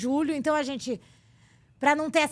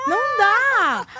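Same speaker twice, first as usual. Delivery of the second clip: slow, higher, louder and stretched; drawn-out exasperation; 8 semitones higher, 7 dB louder, much slower than usual. Real, not acted. Woman on foreign boyfriends, slow exasperated wail. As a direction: draw it out in comic despair.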